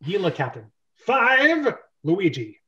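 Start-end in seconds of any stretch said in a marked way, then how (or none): 1.0-1.7 higher, louder count; countdown call. Acted. Captain counting down the execution. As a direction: call the number.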